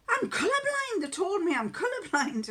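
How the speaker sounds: high, loud imitation of an amazed teenage son; mimicked son. Son excitedly reports colourblind news.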